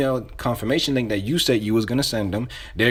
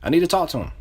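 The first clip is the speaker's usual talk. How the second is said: higher, insistent and familiar; urgent, pitched-up insider; close-friend caller; 7 semitones higher than usual. Shown as a demonstration. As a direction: press them like an old friend being screened. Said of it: "You're calling like your close friend".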